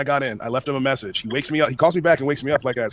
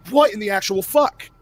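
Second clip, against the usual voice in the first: slow, higher incredulous reaction; retold disbelief; 7 semitones higher, 4 dB louder, slower than usual. Reacting to the friend laughing at him, retold disbelief. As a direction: act out being dumbfounded, stretching the words.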